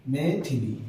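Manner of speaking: मैथिली in three separate syllables; correct middle vowel. Shown as a demonstration. Teacher confirming the correct word, मैथिली with clear "thi". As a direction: say मैथिली as "mai-thi-lee". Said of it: "ये होता है मैथिली शुद्ध आपका".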